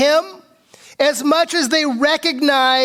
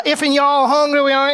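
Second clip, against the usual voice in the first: hillbilly Southern accent. Shown as a demonstration. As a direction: put on a twangy Southern accent. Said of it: "try to imitate that southern accent".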